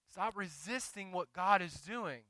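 ordinary talk, nothing marked

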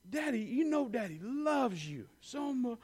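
soft, slow and loving; a father comforting his scared son; tender reassurance. Retold or imitated. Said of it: "I said".

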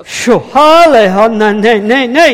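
loud, high, fervent preaching; preaching declaration. Acted. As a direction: declare blessing over the pastor in full force. Declaring prophecy over pastor.

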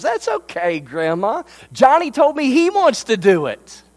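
high, sugary tattletale voice; sly little sister. Retold or imitated. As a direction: act innocent while selling him out. Sister tattles sweetly to grandma.

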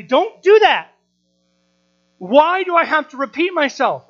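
acted-out outburst at having to repeat yourself; frustrated. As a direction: raise your voice like a fed-up parent. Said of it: "it can get s-, really frustrating".

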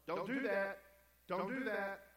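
loud, high, sharp command; scolding parent. Acted. Preacher acting a parent telling a child no, loud scolding. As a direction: call out a mock parental prohibition.

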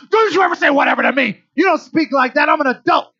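shouted, loud and high, like a furious mom; an angry mom putting a teen in place; mom shouting. Retold or imitated. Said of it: "Mom's like".

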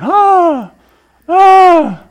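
high-pitched yelp running from a bull; panicked scream. Acted. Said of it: "the guy was screaming".

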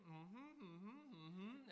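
imitating a mother's sassy mm-hmm with attitude; hammed-up sassy mm-hmms. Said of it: "the attitude was going".

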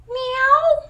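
high, loud kitten meow; child playing a cat. Daughter answering her mom in character as a cat, high meow. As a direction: squeak it out like a little girl pretending to be a cat. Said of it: "'Cause she was playing a cat".

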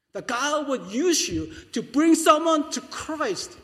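loud, high, impassioned sermon climax; full-force preaching. Acted. Pastor urging the congregation to command by thanks, full-force preaching.

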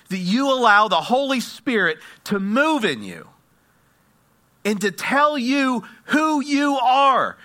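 high, loud, emphatic preacher; impassioned sermon climax, stretching key phrases; full-force preaching. Acted. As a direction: drive each phrase home to the congregation.

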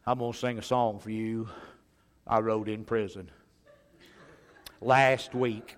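low, quiet, halting drawn-out delivery; rough-looking ex-prisoner. Retold or imitated. Rough guy introducing his song to the church, low and deadpan. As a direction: imitate a rough guy, low and soft with long pauses. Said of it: "This guy, rough looking guy".

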